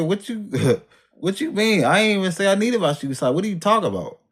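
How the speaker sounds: high, disbelieving laugh-tinged protest; incredulous. Retold or imitated. To the nurse, laughing in high disbelief. Stunned by the nurse's shoe remark.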